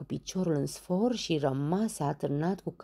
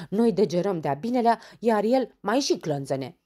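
louder, higher irritated grumble; grumbling; 5 semitones higher, 7 dB louder than usual. Acted. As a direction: complain crossly about him. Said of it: "bombănea Dondănel". (bombănit supărat, mai tare)

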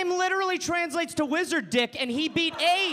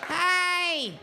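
put-on sappy phone voice for the girlfriend; 2 semitones higher than usual. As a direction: coo at her like a Muppet. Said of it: "really bad girlfriend voice".

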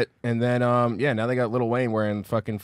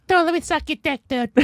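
high Boston accent impression; squeaky Boston voice, comic; Bobby Kelly; 14 semitones higher than usual. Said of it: "Just doing Bobby Kelly".

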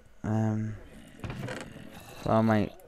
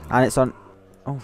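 ordinary delivery

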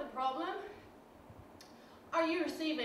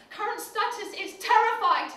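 panicked report; 3 semitones higher, 7 dB louder than usual. Acted. Pilot under attack.

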